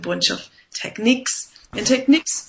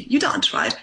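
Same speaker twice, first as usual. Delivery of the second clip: ordinary talk, nothing marked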